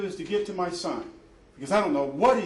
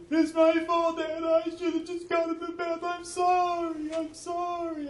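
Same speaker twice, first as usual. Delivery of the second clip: put-on very high, pleading child's sobbing sorry; child's tearful apology; 13 semitones higher than usual. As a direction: beg forgiveness like a scared boy. Son apologizes in distress.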